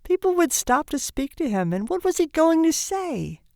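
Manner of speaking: high-pitched, fretful narration; anguished dread. A narrator's reading. Tortured father imagines introductions, anguished and high. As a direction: voice his panic, pitched high. Same passage of imagined shame, reread.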